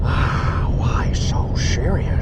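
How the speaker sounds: slow, whispery Joker voice; Joker impression. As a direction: drag it out like a menacing villain. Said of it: "That's pretty good".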